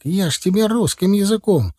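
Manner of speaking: high-pitched, exasperated protest; indignant. A narrator's reading. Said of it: "возмутился Титок".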